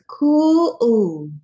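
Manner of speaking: loud drawn-out admiring cool; impressed. A narrator's reading. Narrator admiring the drawing bean, loud drawn-out. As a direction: savour the word loud.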